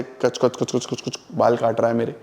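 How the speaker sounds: quick, low snipping noises; imitated scissor snips. Hairdresser cutting hair in a retold story, imitated scissor snips. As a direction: softly mimic scissors going snip-snip.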